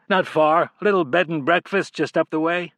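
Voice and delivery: a hint of a wary stranger cutting it short; curt male stranger. A narrator's reading. Narrator voices the stranger.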